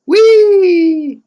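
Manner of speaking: high, loud, drawn-out "whee"; gleeful whee. Pretend. Player as reveler rolling like a hog, squealing whee. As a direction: squeal like someone rolling for fun.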